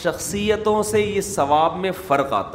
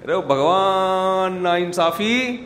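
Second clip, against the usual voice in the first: very slow braying wail; donkey voice; 3 semitones higher, much slower than usual. Pretend. Preacher repeats the donkey's cry, slow braying. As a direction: stretch it out like a donkey's bray.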